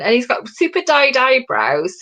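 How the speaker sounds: ordinary talk, nothing marked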